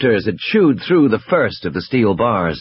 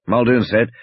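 ordinary delivery, nothing marked

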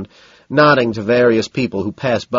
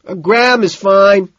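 high, loud, drawn-out answer; slow yell to be heard; shout; 10 semitones higher, 8 dB louder, slower than usual. A narrator's reading. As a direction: holler your order over the music. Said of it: "I shout".

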